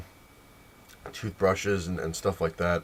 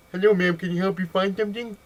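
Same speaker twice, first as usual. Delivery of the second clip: high-pitched, slurred put-on deaf voice; faked deaf speaker; 10 semitones higher than usual. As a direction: mimic muddled consonants of a deaf speaker. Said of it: "I'm deaf, not stupid".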